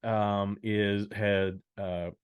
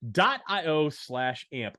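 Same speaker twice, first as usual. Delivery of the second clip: higher-pitched promo pitch; ad read; 8 semitones higher, 3 dB louder than usual. Acted. Host telling listeners where to learn more, ad read. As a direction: sell it like a hammed-up radio spot.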